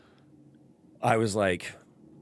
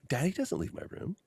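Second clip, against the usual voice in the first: put-on toddler voice; 10 semitones higher, 5 dB quieter than usual. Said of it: "And he goes".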